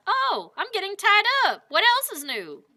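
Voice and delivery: high, light cowboy musing; mock-casual inner voice; [name]'s thought. [name] voicing his own thought.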